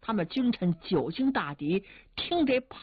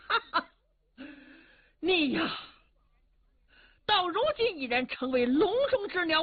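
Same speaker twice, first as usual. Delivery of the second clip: higher, gloating scorn with a laugh; mocking sneer; 6 semitones higher than usual. A narrator's reading. Li Fuzhi gloats over Liu Xiu, sneering mockery. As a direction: taunt a trapped enemy. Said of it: "李福志微微一阵冷笑".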